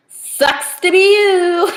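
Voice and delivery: high, loud, drawn-out taunt; mock taunt. To someone too bad for him, singsong taunt. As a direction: stretch it out like a playground jeer. Blurts out a teasing catchphrase.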